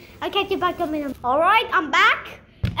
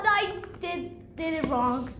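ordinary talk, nothing marked

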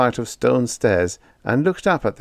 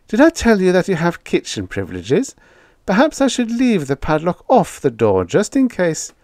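high, chirpy landlady voice; sweetly offering; 10 semitones higher, 4 dB louder than usual. A narrator's reading. Landlady calls down offering kitchen privileges, high and chirpy.

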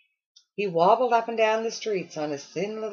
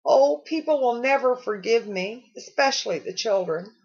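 sobbing; 6 semitones higher, 4 dB louder than usual. A narrator's reading. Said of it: "sobbed the Weatherman".

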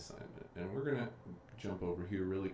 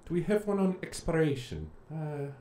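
a playful Irish lilt, pitched higher; Irish accent; 7 semitones higher than usual. Pretend. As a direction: slip into a mock Irish accent while searching. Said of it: "why I'm speaking with an Irish accent".

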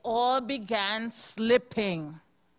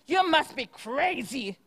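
squawking loon; 3 semitones higher than usual. Acted. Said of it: "squawked a furious loon".

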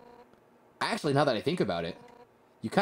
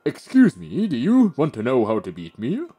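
a hint of a goofy gentle king, louder; lightly voiced Asgore, a bit louder; 6 dB louder than usual. Retelling the king's offer to train her, lightly voiced goofy. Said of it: "he apologized and said something goofy".

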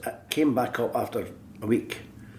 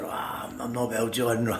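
sick voice; 5 dB quieter, slower than usual. Retold or imitated. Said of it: "I put his voice on".

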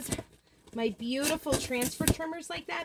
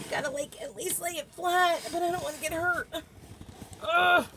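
ordinary talk, nothing marked

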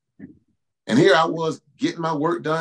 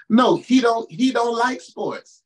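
emphatic; 6 semitones higher, slower than usual. Real, not acted. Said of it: "preacher answering the imagined woman".

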